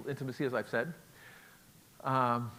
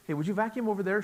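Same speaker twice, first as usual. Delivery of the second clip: plain direct ask, a bit higher and brighter; just say it straight out; blunt request; 8 semitones higher, faster than usual. Shown as a demonstration. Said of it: "She doesn't say like I do".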